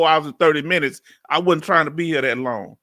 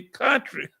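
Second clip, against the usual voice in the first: higher-pitched quoted jab; Chief Mike; 6 semitones higher than usual. Retold or imitated. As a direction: toss out the word as a higher-voiced imitation. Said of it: "Chief Mike say".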